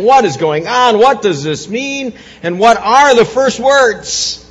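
loud, fervent pulpit preaching; rising Easter sermon fervour; preaching at full force. Acted. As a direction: drive it home to the congregation. Preaching Easter resurrection message.

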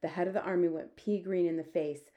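ordinary delivery

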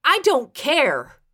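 firm, drawn-out rebuff; angry rebuff. A narrator's reading. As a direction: shut down the plea, stretching the words. Angry rebuff in an acted quarrel.

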